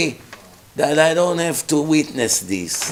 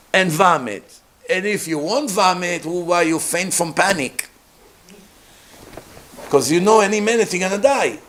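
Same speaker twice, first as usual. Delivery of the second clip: ordinary delivery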